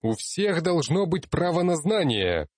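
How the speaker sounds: high, impassioned near shout; almost shouting. A narrator's reading. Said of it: "почти крикнул я".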